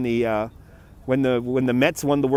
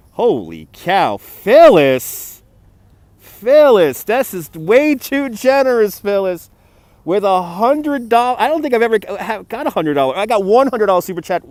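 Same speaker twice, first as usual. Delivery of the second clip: shocked outcry; 7 semitones higher, 8 dB louder than usual. Real, not acted. Reacting to a $100 super chat.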